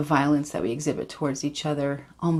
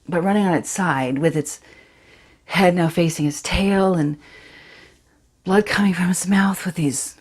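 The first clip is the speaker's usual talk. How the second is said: put-on a distraught fifteen-year-old girl, louder and rising as the memory takes over; Charity, shaken teenager; 5 dB louder than usual. Teen girl confides the fox's death to Aaron, haunted and urgent. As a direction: tell Aaron about the fox, close to breaking. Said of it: "this is Charity from Sam Holcroft's Dancing Bears".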